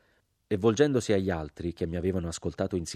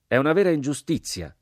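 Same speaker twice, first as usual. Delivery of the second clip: loud, much higher cry of injustice; outraged shout; 8 semitones higher, 8 dB louder than usual. Acted. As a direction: shout it while beating your chest. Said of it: "gridò il sergente".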